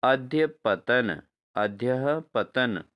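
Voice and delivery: अध्यपतन said very slowly, each syllable clear; slow model word. Shown as a demonstration. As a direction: drag out अध्यपतन for the students. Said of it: "जो इसका शुद्ध रूप होता है".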